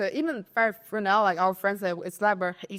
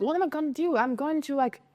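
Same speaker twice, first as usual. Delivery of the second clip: higher, mildly mock-frantic wail; frantic friend; 7 semitones higher than usual. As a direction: imitate the friend losing it a little. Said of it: "frantic at the moment".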